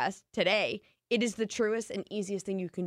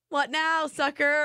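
taunting haters; 6 semitones higher, 3 dB louder, slower than usual. Retold or imitated. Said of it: "they use that as their".

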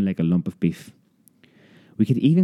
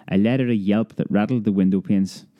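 ordinary delivery, nothing marked

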